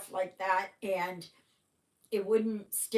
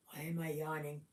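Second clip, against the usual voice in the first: low mutter; 4 semitones lower, 7 dB quieter, faster than usual. Real, not acted. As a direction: lower and softer, to herself. Repeats question, low aside.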